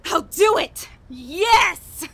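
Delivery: loud, high, crying yes; frantic yes. Acted. Giving in to the voice in her head, crying yes. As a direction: break down and give in, crying out.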